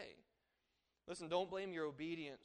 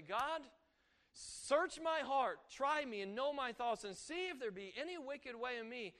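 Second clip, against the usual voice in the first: slow, lifted plea to God; a model prayer voiced to God; earnest prayer; 4 semitones higher than usual. Shown as a demonstration. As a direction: pray it out, drawn and searching.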